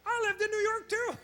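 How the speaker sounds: high-pitched, hammed-up Broadway performer; camp Broadway guy. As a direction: gush it high like a theatrical dancer. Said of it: "a lot of these Broadway guys are".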